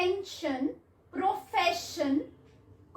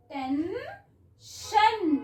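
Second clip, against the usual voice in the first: Tension very slowly stretched out, each sound drawn long; slow blended word; 3 semitones higher, much slower than usual. Shown as a demonstration. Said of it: "ट, ए, न".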